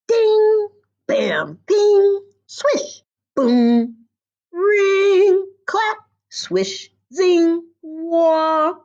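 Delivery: each sound word jumps high and loud, one instrument after another; instrument sound effects. A narrator's reading. The whole orchestra playing together in playful high, loud sound words. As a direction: ring out every sound like a different instrument.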